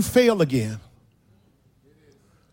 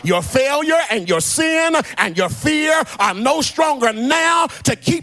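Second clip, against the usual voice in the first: raised, ringing sermon delivery; full-force preaching; 10 semitones higher, 4 dB louder, slower than usual. Acted. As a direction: preach it out like a climax, half shouted.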